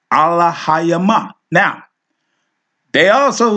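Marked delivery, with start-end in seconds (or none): 0.1-1.3 Allahayama slowly, syllable by syllable, raised pitch; careful pronunciation. Shown as a demonstration. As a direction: draw out "Allahayama" as ah-la-ha-ya-MA. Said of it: "so we see Allahayama".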